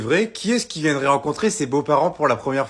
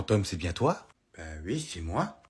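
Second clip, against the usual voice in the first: ordinary delivery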